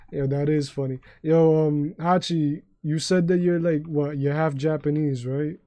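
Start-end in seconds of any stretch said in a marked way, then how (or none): none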